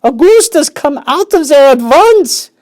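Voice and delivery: a mother yelling at her son in the pipe. Acted. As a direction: yell at him to come out. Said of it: "yelled Missus Gloop".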